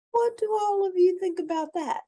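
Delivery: put-on high, bright question to the kids; excited question. Storyteller asks the kids in a high excited voice. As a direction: pitch way up to ask the children. Asking young viewers a question.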